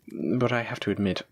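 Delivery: ordinary talk, nothing marked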